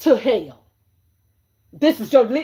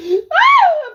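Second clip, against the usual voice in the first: laughing squeal; 9 dB louder than usual. Real, not acted. Burst of laughter.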